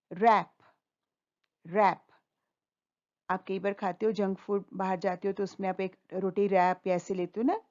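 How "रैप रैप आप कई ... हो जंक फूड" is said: normal talking voice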